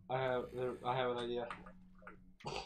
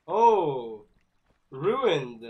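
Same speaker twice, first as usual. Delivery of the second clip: mock dismay; 8 dB louder than usual.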